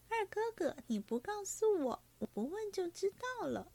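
high, lilting knowing tease; playful teasing. A narrator's reading. Young lady teasing her second brother, playful and knowing. Daiyu teases the quarrelling pair.

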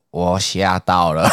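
very slow, dim mock-worried voice; slow dopey friend. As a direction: stretch it out like a clueless recruit. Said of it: "他讲话就很慢".

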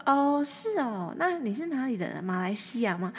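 学大哥拉长的"哦，是哦"，更高更响; 模仿顾客的惊讶追问，声音抬高; 模仿大哥惊讶. Retold or imitated. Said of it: "他就说". (模仿大哥惊讶地追问，更高更响)

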